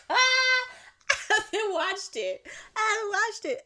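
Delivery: high, loud squealed confession; worked-up, high-pitched and loud; excited outburst. Real, not acted. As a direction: squeal it, stretching the first take out. Gushing confession about the show.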